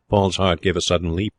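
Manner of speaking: ordinary delivery, nothing marked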